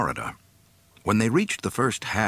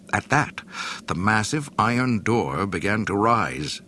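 ordinary delivery

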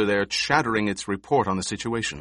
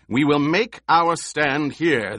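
commanding; 5 semitones higher, 4 dB louder than usual. A narrator's reading. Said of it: "the Emperor ordered".

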